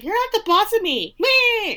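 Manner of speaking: put-on high, loud childish taunt, tongue out on the last word; bratty taunt. Daphne taunting Simon as she runs off, high bratty mock. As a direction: sing it like a sulky kid blowing a raspberry. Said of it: "And she's like".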